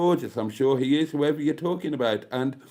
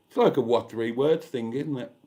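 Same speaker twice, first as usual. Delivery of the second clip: ordinary talk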